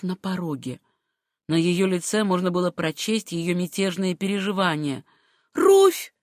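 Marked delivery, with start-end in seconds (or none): 5.5-6.1 high-pitched call of her name; sharp, urgent outcry; passionate cry. A narrator's reading. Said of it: "крикнула она".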